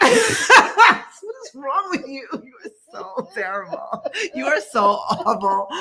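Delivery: crying with laughter, slow; real teary laughter, words stretched; helpless laughter. Real, not acted. Friend scolds co-host while crying with laughter.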